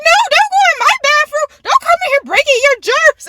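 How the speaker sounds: high, loud mock scolding; scolding mom. Mom banning daughter from her bathroom, scolding. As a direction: imitate mom's high-pitched alarmed objection.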